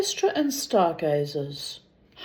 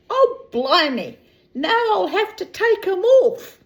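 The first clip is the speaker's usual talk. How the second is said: high, louder put-upon comic voice; grumbling husband; 11 semitones higher, 4 dB louder than usual. Acted. Fred in the bath, put-upon high comic grumble. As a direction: groan at the nuisance in a high comic voice.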